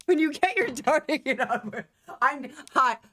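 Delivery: high put-on parroting voice; mocking echo. She parrots his line in mockery.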